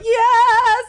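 high, loud drawn-out cheer; pageant coach. Retold or imitated. Coach to kid contestant, mock gushing cheer. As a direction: squeal encouragement like a pageant coach. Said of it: "coach in a kid's beauty pageant".

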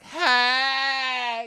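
high, loud shouted greeting; hammed-up yelled hey; mock shouted hey. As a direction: yell it like an overeager bachelor. Guy yelling hey at her.

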